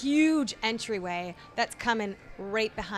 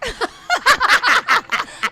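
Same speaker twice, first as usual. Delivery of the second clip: burst of laughter; 13 semitones higher, 12 dB louder than usual. Real, not acted. Burped into her turtleneck.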